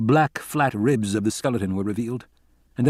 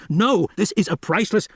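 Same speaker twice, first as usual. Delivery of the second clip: shouting; 9 semitones higher than usual. A narrator's reading. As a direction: cry out to stop them. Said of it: "He shouted".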